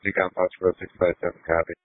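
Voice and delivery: tinny radio transmission; police radio. Real, not acted. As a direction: voice over a dispatch radio. Fire unit radio transmission.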